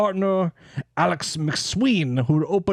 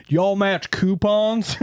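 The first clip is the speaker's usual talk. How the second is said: slow, higher-pitched mock cowboy; 6 semitones higher, much slower than usual. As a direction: play a cowboy customer, drawn out. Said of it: "cowboy comes in".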